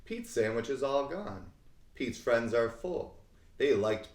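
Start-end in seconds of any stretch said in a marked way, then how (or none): none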